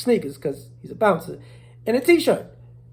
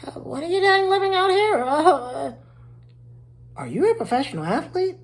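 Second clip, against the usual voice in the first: nosy neighbor; 9 semitones higher than usual. Retold or imitated.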